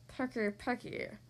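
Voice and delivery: soft, breathy grumble; complaining monster. A narrator's reading. Rejected monster complains about the fussy child, soft grumble. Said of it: "Herbert complained".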